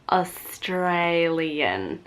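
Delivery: very slow, exaggerated Australian accent; broad Australian accent. Shown as a demonstration. Mocking her own Australian accent, drawn out. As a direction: stretch 'Australian' out in broad Aussie vowels. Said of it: "I sound so Australian".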